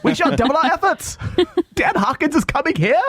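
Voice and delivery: high, frantic imperial officer voice; a nervous officer warning that the Emperor is coming; panicked Star Wars commander. Retold or imitated. Retelling the usher as a panicky Star Wars officer, high and frantic. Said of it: "commander in Star Wars and Darth Vader".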